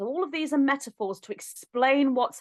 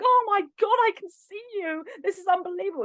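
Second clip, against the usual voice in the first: high, thrilled gasp of disbelief; breathless wonder at seeing the spirit guide; excited; 9 semitones higher than usual. Retold or imitated. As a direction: replay the giddy rush of seeing him. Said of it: "the more excited you get".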